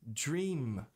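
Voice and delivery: "Dream" held on closed lips; full final M. Shown as a demonstration. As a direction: press the M of "Dream". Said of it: "e não dream".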